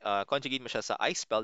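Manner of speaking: normal talking voice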